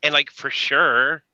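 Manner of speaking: mock a British girl gone Valley girl, bubbly; Valley girl impression. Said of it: "she's got that British accent, but she's like".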